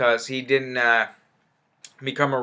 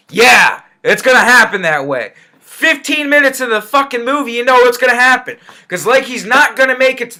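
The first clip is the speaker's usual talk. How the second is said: higher, louder, fed-up outburst; real exasperation, pitched up; exasperated rant; 8 semitones higher, 5 dB louder than usual. Real, not acted. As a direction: let rip at how obvious it is. Exasperated rant at predictable plot.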